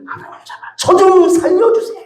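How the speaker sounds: high-pitched imploring prayer; acted cry for mercy, pitched well up; desperate plea. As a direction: beg God to save you. Acts out desperate prayer to God.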